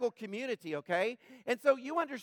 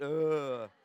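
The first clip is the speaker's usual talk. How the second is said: disgusted groan; 6 semitones lower than usual. Retold or imitated. Jesus's disgust at lukewarm drink.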